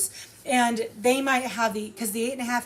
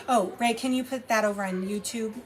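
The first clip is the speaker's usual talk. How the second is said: usual speaking voice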